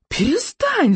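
high, urgent cry; alarmed yell. A narrator's reading. Boy behind mast begs attacker to stop, high yell. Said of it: "завопил Икинг".

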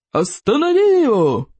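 high, creaking cry of command; terrible screech. A narrator's reading. Teacher demands pupil stop dragon, terrible screech. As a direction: screech the order in a dreadful voice. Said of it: "проскрипел он жутким голосом".

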